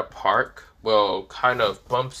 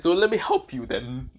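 Luigi-like voice; 6 semitones higher than usual. Acted. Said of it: "why the hell did I sound like Luigi".

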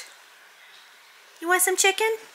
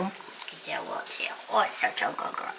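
much softer whispered doggy praise; 5 semitones lower, 9 dB quieter than usual. Real, not acted. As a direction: hush your voice to praise the pup. Praising dog softly.

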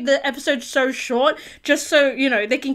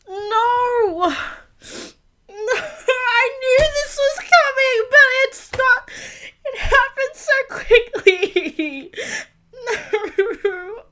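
sobbing while laughing; ugly crying, voice breaking; snotty crying; 7 semitones higher, much slower than usual. Real, not acted. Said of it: "I'm ugly crying now".

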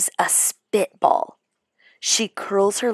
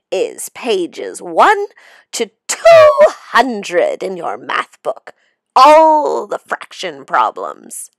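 sinister teacher; 9 dB louder than usual. Acted. Monster teacher gives homework.

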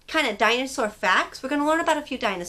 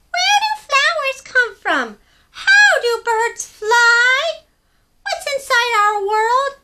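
high, loud curious little penguin voice; a tiny penguin full of questions; 14 semitones higher, 8 dB louder, slower than usual. Acted. Story reader voicing small penguin Pipkin asking big questions. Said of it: "very small penguin".

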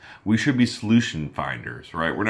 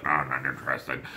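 much lower, soft mumbled no; low dismissive mutter; 10 semitones lower, 6 dB quieter than usual. Retold or imitated. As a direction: shrug the caller off quietly. Customer brushing off the call.

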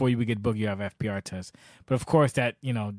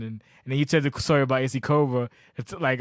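ordinary talk

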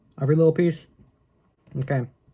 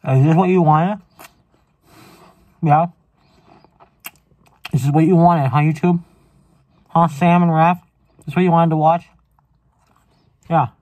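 mock angry rant; 5 dB louder than usual. Yelling mock rant at YouTube viewers.

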